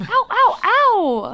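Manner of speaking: high, loud whooping ow; cat-call howl. Pretend. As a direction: howl like a wolf whistle.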